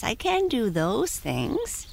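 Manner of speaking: high, slow modest pony; talking pony. Acted. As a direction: admit it shyly like a pony. Prancer voice.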